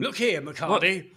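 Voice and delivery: lightly put-on stern boss voice; mock telling-off. Co-host imagining the colleague confronting the producer, mock stern. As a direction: play at calling someone to account. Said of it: "exactly the tone I used".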